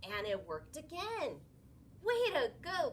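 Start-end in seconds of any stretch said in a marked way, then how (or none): 2.0-2.9 high, loud praise; cheering. Acted. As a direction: friends cheer their buddy. Friends cheer Clark.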